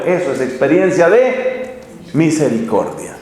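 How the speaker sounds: ordinary talk, nothing marked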